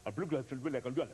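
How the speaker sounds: gibberish imitation of Russian translation; mock Russian translator. Translator passing the remark to Gorbachev, mock Russian. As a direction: rattle off mock-Russian nonsense. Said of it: "So the translator".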